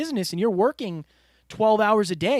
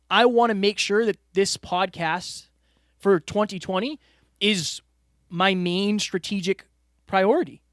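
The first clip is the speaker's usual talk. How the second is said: ordinary talk, nothing marked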